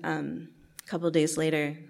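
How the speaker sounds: usual speaking voice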